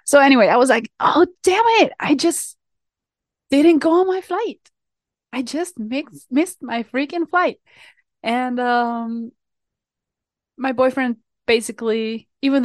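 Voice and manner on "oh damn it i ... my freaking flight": frustrated outburst. Retold or imitated. Realizing she missed her flight.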